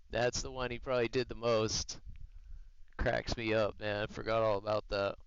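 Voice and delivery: usual speaking voice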